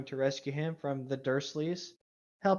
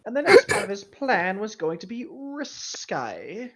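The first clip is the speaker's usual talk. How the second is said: higher, louder, slow dramatic read; hammed-up reading; 7 semitones higher, 8 dB louder than usual. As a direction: read it like a grand melodrama, slowly. Hamming up the fic reading.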